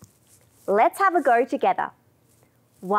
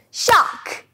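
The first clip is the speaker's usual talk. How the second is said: high, loud, sudden shark; 9 semitones higher, 4 dB louder than usual. Shown as a demonstration. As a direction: snap the word like jaws. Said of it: "big loud shark".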